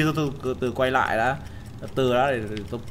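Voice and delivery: low, soft tense murmur; hushed. Real, not acted. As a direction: hold back, keep it down. (thì thầm căng thẳng)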